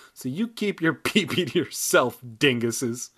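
usual speaking voice

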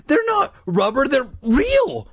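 high-pitched frightened protest; trembling insistence. A narrator's reading. Mike insisting to friends the snakes are real, frightened. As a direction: insist in alarm, pitched well up. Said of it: "his voice trembling".

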